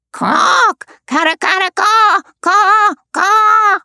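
very high, rising squawking caws; raucous parrot call. A narrator's reading. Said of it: "a loud and raucous".

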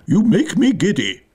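high, irritated queen screech; mildly screamed royal complaint. A narrator's reading. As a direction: complain shrilly, without full volume. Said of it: "screamed the Queen".

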